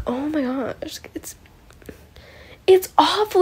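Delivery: hushed and horrified, barely voiced; horrified whisper. Recapping the episode to listeners, whispering in horror. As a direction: whisper it, aghast, almost breathless. Retelling her horrified reaction to the scene.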